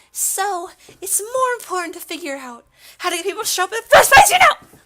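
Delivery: very high, loud cartoon girl voice; whiny club member. Club member arguing about getting people to come, squeaky. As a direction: squeal the objection, building to a shriek. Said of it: "I don't know why I'm, like, saying it like that".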